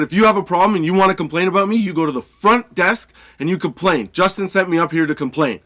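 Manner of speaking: emphatic, defiant re-enactment; assertive comeback. Retold or imitated. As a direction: claim your authority forcefully. Retold telling-off continues.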